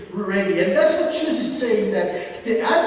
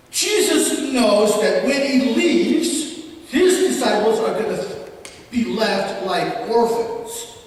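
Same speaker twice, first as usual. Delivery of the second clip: usual speaking voice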